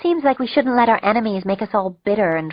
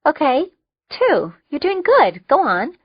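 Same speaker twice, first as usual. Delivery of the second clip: encouraging; 8 semitones higher, 4 dB louder than usual. Acted. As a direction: cheer him on like a coach. Max encouraging Total.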